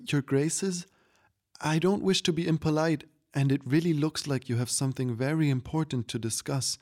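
put-on high, hesitant little-girl plea; falter, then plead politely; begging child. A narrator's reading. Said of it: "Claudia begged".

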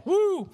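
high, loud "woo"; short high whoop of relief; relieved whoop. Real, not acted. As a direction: let out a quick high woo. Relief after stumbling over a word.

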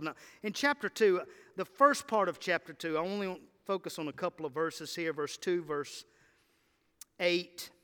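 ordinary talk

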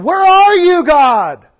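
high, loud, anguished cry at God; cursing cry to God. Retold or imitated. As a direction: hurl it upward, accusing. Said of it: "They were cursing God and saying".

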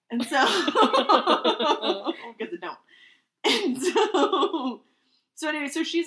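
laughing through the words; breaking into laughter mid-sentence. Real, not acted. Laughing at her own joke.

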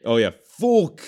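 high, loud mock Cockney swearing; imitating Jamie Tartt's thick Cockney; hammed-up Cockney footballer. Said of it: "how Jamie Tart talks".

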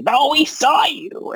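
high, drawn-out goblin protest; arguing goblin. Acted. Goblins argue back at their accusers, high goblin voice. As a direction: squeal it as an indignant goblin.

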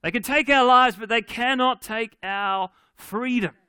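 loud, high battle cry; Scottish yell. Acted. Preacher redoing Braveheart speech for the church, Scottish yell. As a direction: yell it out like a rallying warrior. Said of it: "a good Scottish yell".

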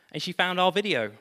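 usual speaking voice

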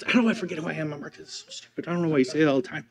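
put-on muttered, sulky teenage complaint; teenage grumble. Preacher voicing his teenage self grumbling at mom's reminder. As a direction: grumble it like a fed-up fifteen-year-old. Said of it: "made me so frustrated".

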